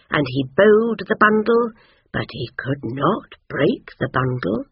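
ordinary delivery, nothing marked

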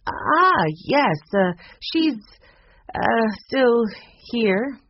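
high, halting, faltering over each word; hesitant feigned vagueness. Acted. Barbara stalling when asked about Mary, hesitant and halting. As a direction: stall as if the name is unfamiliar. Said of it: "as though she'd never heard the name before".

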